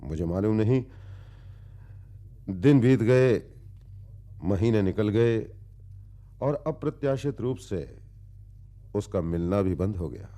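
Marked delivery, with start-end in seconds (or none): 2.5-5.5 emphatic narration. Acted. Narrator recalls passing days to companion, emphatic. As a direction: announce the passing days with force.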